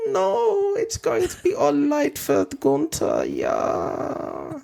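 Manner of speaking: put-on sexy German accent. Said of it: "is that your sexy Gunther voice".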